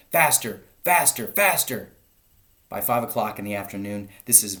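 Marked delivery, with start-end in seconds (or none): 0.1-1.9 loud, high, drawn-out urging; frantic shouting. A narrator's reading. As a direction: whip the diggers on, shouting. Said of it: "hopping about like a dervish and shouting".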